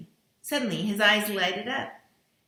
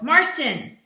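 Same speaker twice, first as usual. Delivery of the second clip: higher, louder shout of a name; calling out; 8 semitones higher, 10 dB louder than usual. A narrator's reading. Lombard calling Marston to help, a loud urgent shout. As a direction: call him over urgently. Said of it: "Lombard called".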